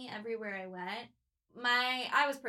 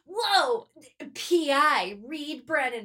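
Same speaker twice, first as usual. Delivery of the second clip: loud, hammed-up self-introduction; mock detective announcement; 3 semitones higher, 9 dB louder, much slower than usual. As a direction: announce yourself loudly like a private eye. Reed announces herself to Trey.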